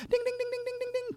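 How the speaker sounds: high ringing ding ding; bus bell ding. Acted. Narrator imitates the bus bell ringing, very high.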